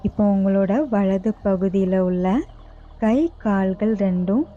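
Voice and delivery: slow, even yoga nidra guidance with long pauses; guided meditation. Real, not acted. Yoga teacher guiding listeners lying down to relax each body part, guided meditation. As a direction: lead them unhurriedly into rest, phrase by phrase.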